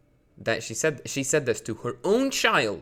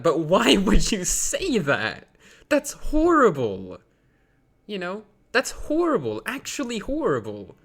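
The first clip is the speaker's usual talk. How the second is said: mock outrage; 8 semitones higher, 5 dB louder than usual.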